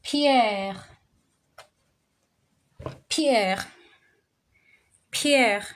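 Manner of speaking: Pierre with a throaty French R, pee-ehr. Shown as a demonstration. Teacher showing the French R in Pierre. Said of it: "Peter in French is".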